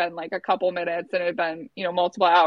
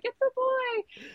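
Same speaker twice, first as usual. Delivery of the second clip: retold tearful-happy squeal; emotional announcement; 5 dB quieter, slower than usual. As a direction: squeak out joyful news slowly. Retold birth announcement, tearful room.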